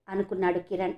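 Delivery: plain reading voice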